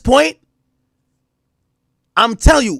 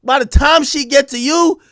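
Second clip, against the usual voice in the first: ordinary talk, nothing marked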